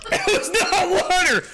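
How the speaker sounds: high, laughing protest; cracking up, high-pitched; laughing outburst. Real, not acted. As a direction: blurt it out through laughter. Reacting to fake water, laughing.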